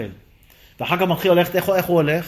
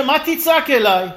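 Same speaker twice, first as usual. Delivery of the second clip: raised, drawn-out challenge; God challenging Moses; 6 semitones higher than usual. Acted. God answering Moses at the sea, raised challenge. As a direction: demand it slowly, high in pitch. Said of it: "ויאמר השם אל משה".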